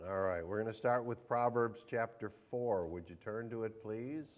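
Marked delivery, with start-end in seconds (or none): none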